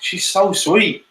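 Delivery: high, drawn-out cooing; melting adoration. Real, not acted. Listener melts at the child's words.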